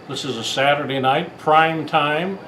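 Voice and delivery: ordinary talk, nothing marked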